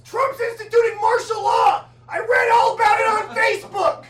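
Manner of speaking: hammed-up alarm, yelling the news; panicked yell. Panicked neighbor running up yelling.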